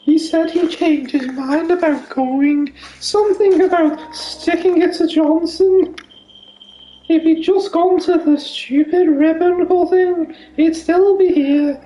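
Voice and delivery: bitter posh widow. Pretend. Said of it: "he's doing the coup now".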